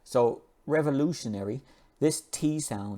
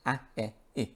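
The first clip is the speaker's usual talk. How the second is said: ordinary talk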